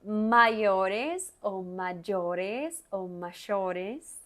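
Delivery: drawn out, word by word; slow teacher drill; slow repetition. Shown as a demonstration. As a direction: say each mayores very slowly. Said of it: "Entonces vamos allá".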